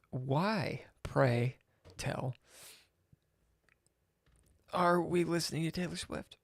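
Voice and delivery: put-on arch, quiet, old-fashioned politeness; prim mock-polite question to the landlord about the music; mock formal. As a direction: ask with exaggerated Victorian courtesy. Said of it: "something along the lines of".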